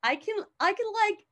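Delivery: high, pleading plea for mercy; hammed-up high-voiced pleading; begging for my life. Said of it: "this man is pleading for his life".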